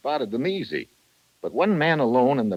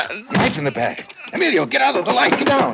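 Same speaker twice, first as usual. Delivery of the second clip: urgent alarmed shout; 6 dB louder than usual. Acted.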